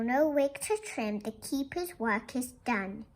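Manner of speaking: gentle, measured read-aloud voice; storybook reading. Acted. Teacher reading a picture book to the class, gentle storytelling. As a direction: tell it softly to the class like a bedtime book.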